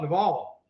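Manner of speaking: "Naval" said nuh-VAHL; name pronunciation. Real, not acted. Co-host corrects the name of the man discussed, "Naval" as nuh-VAHL. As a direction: stress the second syllable of "Naval", with an ah.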